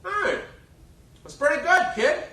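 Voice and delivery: big brassy Broadway diva, generous. Retold or imitated. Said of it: "in that booming voice".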